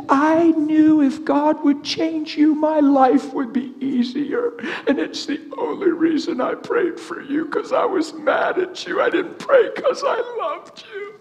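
high, slow sobbed confession; crying. Retold or imitated. Preacher voicing wife confessing why she prayed, crying. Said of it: "crying profusely".

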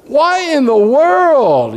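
slow, high, stretched-out disbelief; exasperated sailors calling out at Jonah; incredulous sailors. Acted. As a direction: sailors demanding why in the world. Sailors rebuking Jonah, acted.

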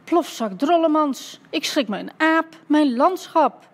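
high, loud, drawn-out exclamations; startled scolding. Acted. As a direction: curse in shock, stretching the words. Startled painter scolds after being bumped. (geschrokken gefoeter)

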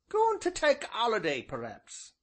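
put-on high old countryman's voice; old rustic innkeeper. A narrator's reading. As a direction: voice the old villager, folksy and drawn. Ancient's dialect voice.

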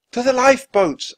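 high, loud urgent yell. A narrator's reading. Annie warns the card players, yelling. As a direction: yell it across the room. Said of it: "Annie yelled at the card players".

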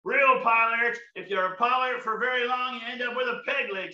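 ordinary delivery

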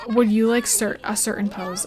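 ordinary talk

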